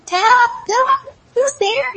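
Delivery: very high, loud call. A narrator's reading. Wolf knocking at grandmother's door and her answer, high shouted call.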